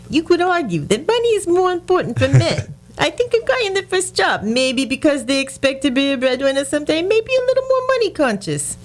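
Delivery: mock Bronx accent. Radio host redoing the senator's quote for his co-host, in a mock Bronx accent. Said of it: "I should have done it in a good Bronx accent".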